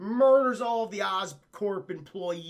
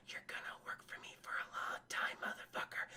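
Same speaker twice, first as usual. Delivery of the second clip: creepy whisper; 13 dB quieter than usual. Acted. Streamer as Kingpin rubbing Smythe's shoulders, creepy whisper. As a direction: whisper it like a villain claiming his prey.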